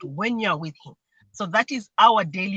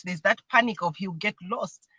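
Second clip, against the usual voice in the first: usual speaking voice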